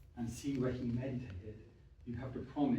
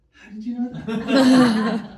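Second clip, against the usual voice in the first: high, loud, slow amazed laugh; astonished mum; 10 semitones higher, 16 dB louder, much slower than usual. Retold or imitated. Mum amazed her daughter read her palm. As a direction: gasp it out in amazed disbelief.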